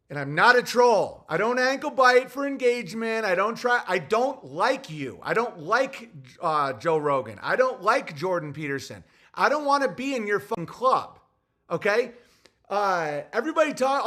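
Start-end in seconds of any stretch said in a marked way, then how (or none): none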